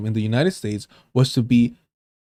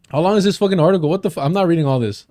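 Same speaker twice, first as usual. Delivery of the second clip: fast, higher-pitched protest; exasperated; 4 semitones higher, faster than usual. Real, not acted. Streamer balking at a long article mid-reading, exasperated. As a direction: blurt it out in disbelief, rushing.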